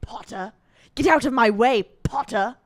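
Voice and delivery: lightly imitated schoolboy villain, dropping the R; a hint of the rival's sneered surname; Draco. Rival ordering the hero aside, lightly imitated villain. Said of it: "I love the way Draco says".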